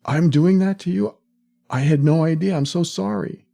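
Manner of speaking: a loving partner, shocked to learn they hurt you; stunned apology. Acted. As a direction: gasp it out as if you just found out. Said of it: "is gonna say".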